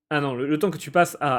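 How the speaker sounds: ordinary delivery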